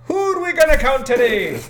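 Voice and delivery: hammed-up vampire that turns Irish; mock Irish count. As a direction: Dracula with a mock Irish accent. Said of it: "Immediately fucking shillelaghs".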